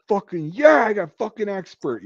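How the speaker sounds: high, elated boast; reenacted burst of celebration; triumphant cheer. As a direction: crow about the score, loud at first. Retold celebration of qualifying expert.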